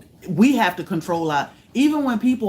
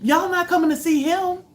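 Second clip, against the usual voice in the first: alarmed yelling; 13 semitones higher than usual. Retold or imitated. Warning the neighbor off her dog, high urgent shout. Dog heading toward Thor.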